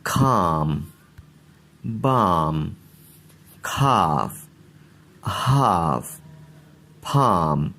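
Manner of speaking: silent L, long aa: खाम, बाम, खाफ, हाफ, फाम; model pronunciation. Shown as a demonstration. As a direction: say each word slowly with a broad aa. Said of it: "अब इन अल्फाज को देखें". (एल बोले बिना लंबी आ की आवाज़)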